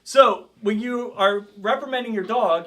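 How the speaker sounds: ordinary talk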